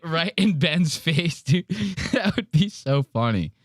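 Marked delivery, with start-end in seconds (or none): none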